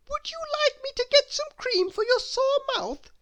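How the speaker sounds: high, eager little helper voice asking anxiously; a fussy, over-eager helper, lightly worried. Acted. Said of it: "she asked anxiously".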